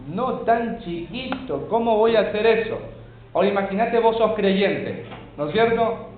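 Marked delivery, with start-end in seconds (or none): 0.1-2.8 higher-pitched indulgent parent, lightly acted; acted parental protest, pitched up. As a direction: plead mildly, as if unable to hurt a child. Acting a soft, indulgent parent's objection.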